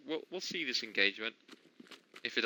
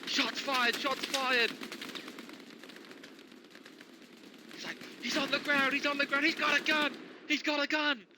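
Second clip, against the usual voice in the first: frantic cop yelling high; police radio; 11 semitones higher than usual. Pretend. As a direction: shout it like a panicked officer. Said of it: "Okay, sorry".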